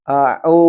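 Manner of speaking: au with a true ain from mid-throat; correct ain. Shown as a demonstration. Teacher demonstrating the right ain in au. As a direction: say au starting with ain squeezed from the middle of the throat. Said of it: "Seperti contoh".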